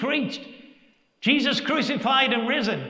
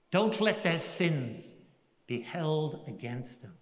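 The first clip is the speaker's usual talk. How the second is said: a quietly voiced dying prayer; hushed dying words; 5 semitones lower, 6 dB quieter than usual. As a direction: let the martyr's last plea fade to a hush. Quoting martyr's dying words.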